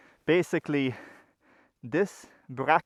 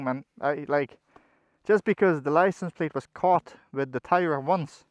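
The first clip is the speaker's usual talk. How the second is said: ordinary talk, nothing marked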